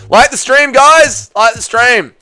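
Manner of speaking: high, loud call to the viewers. Real, not acted. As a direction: urge the crowd, pitched right up. Calls out to the viewers.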